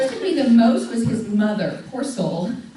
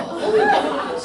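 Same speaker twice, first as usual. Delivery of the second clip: bright high burst of laughter; 4 dB louder than usual. Real, not acted. Mother laughing at her daughter's complaint, high laugh.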